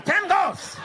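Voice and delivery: "Ten goals" shouted high and loud; yelled ten goals. Retold or imitated. Dad yelling his demand at his sons, loud and high.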